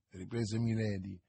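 usual speaking voice

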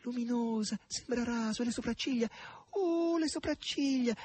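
very high-pitched gushing admiration; rapturous cries. A narrator's reading. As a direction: exclaim in raptures, drawing out the wonder. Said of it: "baciandola e gridando".

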